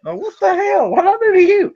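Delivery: very high-pitched startled protest; exaggerated high, offended disbelief; mock affronted shock. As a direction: squeal it as a wronged innocent, hammed up. Acting out startled reaction to rudeness.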